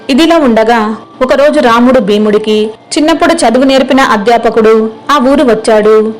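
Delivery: ordinary delivery, nothing marked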